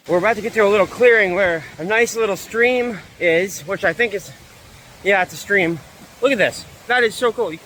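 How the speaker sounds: high, loud burst of delight; excited shouting at the stunning view; thrilled. Real, not acted. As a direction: gush about the stream and the view. Reaching the stream clearing, thrilled.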